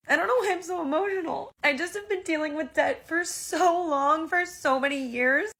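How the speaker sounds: real tears choking the words; tearful crying. Real, not acted. Creator crying to her viewers over paying off debt. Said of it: "Gomez saw me crying".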